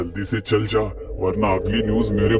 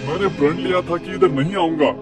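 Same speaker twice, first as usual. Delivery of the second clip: higher, frantic begging voice; scared pleading; 5 semitones higher than usual. Acted. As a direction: beg for your life. Gopal begs the ghost.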